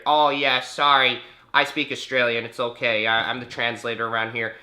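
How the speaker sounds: loud, put-on phone helper; Canadian translator. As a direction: imitate an obliging Canadian on a call.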